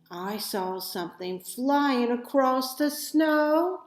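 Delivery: ordinary delivery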